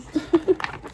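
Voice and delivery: breathy high giggle. Real, not acted. Reader laughing at her own flub, high giggle. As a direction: giggle at yourself, high and airy.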